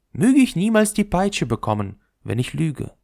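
the top courting the ball, showing off; proud spinning top. Acted. Top swearing an oath.

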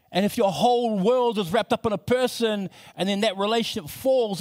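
rising, impassioned appeal to the crowd; preaching. Acted.

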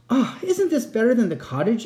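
high, proud exclamation; proud wife. A narrator's reading. Wife showing the fisherman the castle, proudly. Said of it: "asked the wife proudly".

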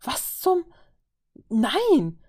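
a desperate host cries out at his brawling guests; desperate outburst. Acted. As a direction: throw up your hands in despair. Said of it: "mit einem verzweifelten Unterton".

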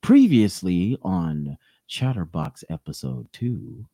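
slow, low TV recap announcer; previously on recap. Acted. Announcing show recap again.

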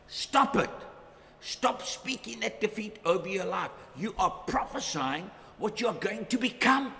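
ordinary talk

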